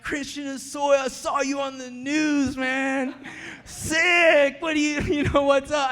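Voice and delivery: loud, high, hyped-up yelling inmates; excited inmates shouting. Retold or imitated. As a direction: greet him like a celebrity, shouting. Excited inmates greeting him.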